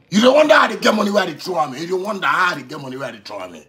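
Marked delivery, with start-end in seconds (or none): 0.1-1.8 louder challenge. Real, not acted. Repeats line, first raised.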